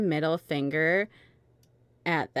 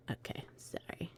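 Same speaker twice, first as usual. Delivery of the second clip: whisper; 18 dB quieter than usual. Real, not acted. Whispered aside while reading.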